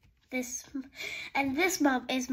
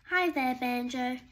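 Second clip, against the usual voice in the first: ordinary delivery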